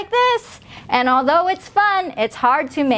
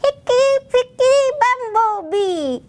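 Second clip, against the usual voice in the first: much higher puppet chick voice; 10 semitones higher than usual. Shown as a demonstration. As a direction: repeat it like the little chick. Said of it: "can you say that".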